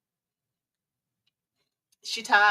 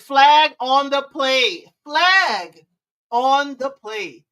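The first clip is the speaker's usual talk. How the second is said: slow, louder referee announcement; referee call; 2 semitones higher, 6 dB louder, much slower than usual. Retold or imitated. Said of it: "K Sharp said".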